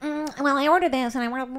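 fussy fast mock penny-pincher; mock stingy diner. Mocking cheap friends at dinner, fussy mimicry. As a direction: mimic a cheapskate tallying the bill. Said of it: "I hate people that are like".

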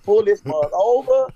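high, loud imitated demand; alarmed wife impression. Wife to speeding husband, mimicked high alarmed demand.

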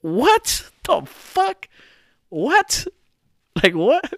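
high-pitched, drawn-out incredulous questions; stunned disbelief. Retold or imitated. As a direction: stretch it out high, each question rising in bafflement. Stunned reaction to absurd confession.

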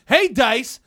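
high, loud demand; imitated irate boss; mock angry boss. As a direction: holler across the office, hammed up. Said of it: "somebody like".

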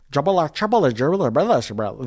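loud mock-American gobbledygook; mocking American English. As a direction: babble like Brazilians mocking American speech. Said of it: "they would make fun of us, the Americans, and they'd go like".

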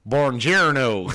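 loud, slow Buongiorno with a mock Tennessee accent; Tennessee-accented Italian. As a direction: imitate bad movie Italian, heavy on the Tennessee accent. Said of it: "His, his Tennessee accent".

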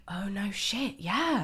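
hushed whispered dawning realisation. Retold or imitated. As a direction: whisper it like a guilty secret. Boyfriend realising he forgot.

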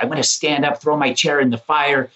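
high, excited boasting; defiant bravado. Retold or imitated. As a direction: pitch it up and brag. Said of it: "I was like".

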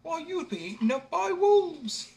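high, slow scornful mother; a mother warning with scorn, high and slow; mother mocking her foolish son. A narrator's reading. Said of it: "she asked".

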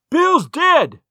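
high, loud cry of news; shouting from house. A narrator's reading. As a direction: shout the shocking news out to those outside. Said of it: "a voice shouting from the house".

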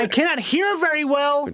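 high-pitched hammed-up server; =keep the high, showy waiter voice going; jazz brunch host. Put-on server Martin continues.

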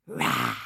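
very low roar. A narrator's reading. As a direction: let out a deep beastly roar. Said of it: "He roared".

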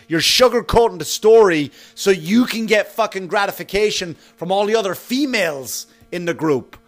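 normal talking voice

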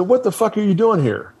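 high, sharp mock-instructor demand; retold angry instructor. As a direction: confront him like a fed-up drill instructor. Instructor confronting him, retold.

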